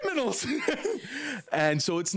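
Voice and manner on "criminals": higher pitch, laughing through it. Real, not acted. Laughing at the Disney cast member's wink, a high giggling criminals. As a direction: giggle the word out.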